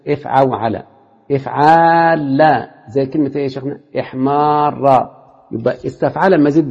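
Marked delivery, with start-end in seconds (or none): 1.3-2.4 افعال with a very long aa, then a double ل; long alif, doubled lam. Shown as a demonstration. Teacher showing a verb pattern, افعال stretched on aa into doubled ل. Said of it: "الالف وبعد كده اللام المشددة". 4.0-5.1 احمار with a very long aa, then a double ر; long alif, doubled ending. Shown as a demonstration. Teacher giving the example, احمار stretched on aa into doubled ر. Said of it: "الالف وبعد كده اللام المشددة".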